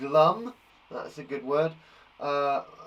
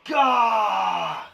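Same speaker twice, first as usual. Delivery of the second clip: long, high, loud "gah" of frustration; angry shout; 7 semitones higher, 8 dB louder than usual. Shown as a demonstration. Teacher lost for angry words, mock frustrated yell. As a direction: burst out in hammed-up exasperation.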